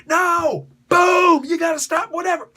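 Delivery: loud, high yell of a hothead; hotheaded hero shouting at his ally. Retold or imitated. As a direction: bark it like a hothead who won't listen. Spider-Man yelling, punching someone.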